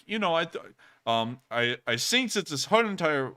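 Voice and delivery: a failed attempt at a Russian accent sounding German; botched Russian accent. Streamer imitating a Russian supporter, in a slipping mock Russian accent. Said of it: "that's a German accent".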